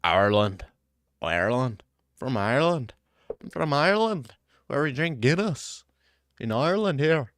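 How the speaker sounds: hammed-up Irish accent; mock Irish accent. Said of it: "You got".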